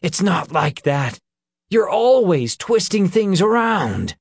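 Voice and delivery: high, loud angry shout. A narrator's reading. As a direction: shout back in denial. Peter angrily denies.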